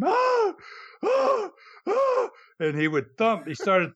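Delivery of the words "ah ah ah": very high, mock pained yelps; imitated scream. Mickey yelping at every thump, imitated high scream. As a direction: squeal like each thump hurts. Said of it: "Mickey would scream".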